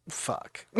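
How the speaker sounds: very soft, voiceless swear; deflated, under the breath; whispered curse. Retold or imitated. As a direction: whisper it in defeat. Deflated curse after reliving the loss.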